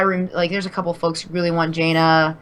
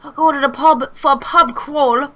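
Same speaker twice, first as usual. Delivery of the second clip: exaggerated British accent; 7 semitones higher, slower than usual. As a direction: go full cartoon Brit, laughing through it. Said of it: "impression of my accent".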